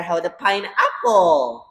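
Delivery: ordinary delivery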